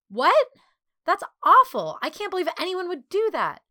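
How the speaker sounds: high, loud outburst of dismay; loud, horrified. A narrator's reading. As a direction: exclaim in horror, pitch way up. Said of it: "she said loudly".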